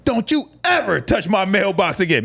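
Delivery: high, indignant telling-off; mock scolding. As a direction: imitate an outraged old lady warning someone off. Acted neighbour scolding in story.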